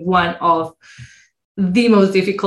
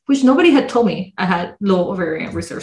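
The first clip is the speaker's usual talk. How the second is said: ordinary talk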